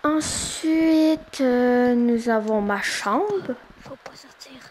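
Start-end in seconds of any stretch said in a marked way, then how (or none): 3.8-4.6 very soft urgent whisper. Real, not acted. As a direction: breathe the warning. Warning hidden friend quietly.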